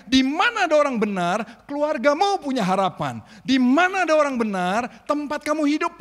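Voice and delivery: loud, high, insistent preaching; full-force preaching. Acted. Preacher declaring hope to the congregation. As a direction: drive it home to the church.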